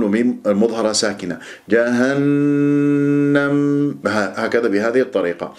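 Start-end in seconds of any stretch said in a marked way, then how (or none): 1.7-3.5 جهنم with a very long steady n hum; fullest ghunna. Shown as a demonstration. Teacher shows complete nasal hold in جهنم, slow chant. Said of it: "الغنة أكمل ما تكون".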